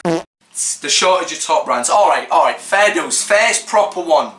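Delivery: ordinary talk, nothing marked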